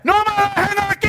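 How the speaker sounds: very high, loud desperate yell; full-force acted cry; screaming for help. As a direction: scream it up from the pit with all you have. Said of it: "de pararme y gritar".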